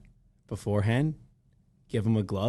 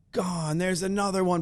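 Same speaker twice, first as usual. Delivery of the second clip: higher-pitched mock play-by-play call; imitated commentator; 6 semitones higher than usual. Co-host mimicking the Home Run Derby commentary, higher-pitched announcer call. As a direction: imitate a commentator rattling off calls. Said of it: "the commentary is like".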